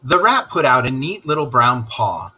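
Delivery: ordinary delivery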